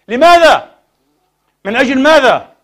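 loud, high, demanding questions; outraged shout. Real, not acted. Preacher asking why old Aleppo was destroyed, outraged shout. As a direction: demand an answer from the whole congregation.

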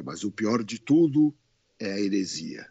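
grave pronouncement. Retold or imitated. Retelling a zealot's solemn pronouncement.

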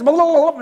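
loud, high babbled filler; rattled-off gibberish. Retold or imitated. Imitating a walking Bible quoting Revelation, loud babble. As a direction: babble like reeling off verses. Mimics man rattling off scripture.

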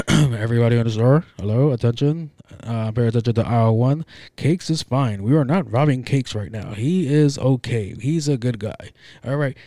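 booming announcement to the whole store; mock store PA announcement, loud and cheerful. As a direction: call it out so every aisle hears. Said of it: "He said it kind of loud for people to hear".

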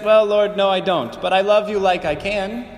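normal talking voice

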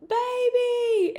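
high, loud, drawn-out delighted holler; long high squeal of greeting; mom hollering excitedly. Retold or imitated. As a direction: imitate an overjoyed mother shrieking. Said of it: "like, hollering and is so excited".

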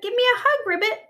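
very high, loud rabbit cry; excited shout. Acted. Rabbit asking Ribbit for a hug, excited shout. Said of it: "shouted Rabbit".

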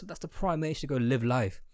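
normal talking voice

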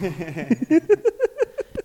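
sudden high-pitched burst of laughter. Real, not acted. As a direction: laugh out loud, squealing up high. Laughing at the email joke.

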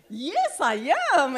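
high, loud, gleeful declaration; exuberant, laughing-at-yourself retelling; excited in the spirit. Retold or imitated. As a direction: proudly belt it out, re-enacting your excitement. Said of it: "I was so excited in the spirit".

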